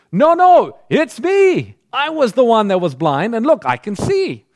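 high, loud cry of wonder; the blind man bursting with joy that he can see; overjoyed shout of a healed man; excited joy. Acted. Healed blind man insists joyfully.

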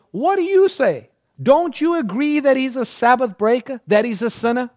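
high, loud and indignant; a demanding crowd of Pharisees cornering the healed man; sharp, pushing interrogation; accusing Pharisees. Acted.

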